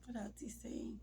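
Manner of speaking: soft whispered question to herself; real whisper. Real, not acted. Widow trying to recall husband's words, whispered. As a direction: whisper it, searching your memory.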